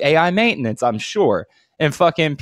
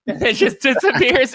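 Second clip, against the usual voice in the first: usual speaking voice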